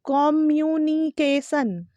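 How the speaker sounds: "कम्युनिकेशन" as kom-yoo-nee-kay-sun, slow; wrong pronunciation. Shown as a demonstration. Teacher reads it as spelt, "कम्युनिकेशन" mispronounced kom-yoo-nee-kay-sun. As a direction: sound out "कम्युनिकेशन" syllable by syllable with KOM. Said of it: "फिर से बिल्कुल गलत है".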